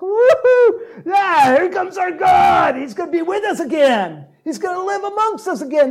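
high, loud jubilant shouting; cheering crowd. Retold or imitated. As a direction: shout for joy as the ark arrives. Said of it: "they're just".

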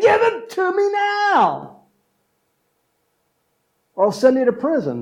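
high, loud menacing demand; threatening demand. Acted. Pastor acts servant choking his friend, loud high threat. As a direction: squeeze the debt out of him. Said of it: "he started strangling him".

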